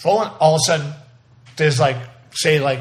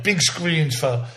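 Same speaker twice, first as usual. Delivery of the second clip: ordinary delivery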